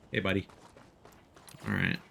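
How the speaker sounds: ordinary talk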